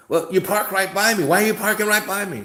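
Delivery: high, loud and fast suspicious complaint; hammed-up loud suspicious woman; mock loud accusation. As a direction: shout it like a paranoid woman. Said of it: "She goes".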